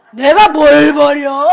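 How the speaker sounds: loud, drawn-out thug retort; gangster. Retold or imitated. Gangster denying he littered, loud and drawn-out. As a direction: play an indignant thug, slow and loud. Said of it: "깡패라니까".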